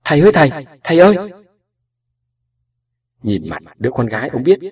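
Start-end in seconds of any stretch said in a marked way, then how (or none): 0.0-1.1 loud, fast, high panicked cry for father; a frightened girl yelling for her dad; hớt hải gọi. Acted. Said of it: "Hoa hớt hải đến gọi". (hốt hoảng gọi to, nhanh và cao)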